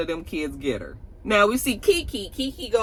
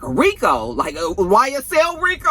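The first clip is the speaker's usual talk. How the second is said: incredulous question; 4 semitones higher, 4 dB louder than usual. Retold or imitated. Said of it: "he was like".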